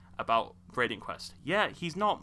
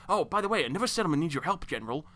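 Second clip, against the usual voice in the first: higher, chirpy mock quest-giver; lightly mocking, raised-pitch interruption; Preston Garvey; 5 semitones higher, faster than usual. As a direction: imitate an over-eager helper with yet another errand. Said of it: "He will go".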